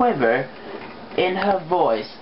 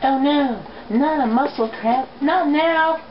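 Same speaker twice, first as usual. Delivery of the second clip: higher, alarmed cry of dismay; 7 semitones higher than usual. Acted. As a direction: panic as the cramp hits mid-flight. Rainbow Dash panics mid-flight.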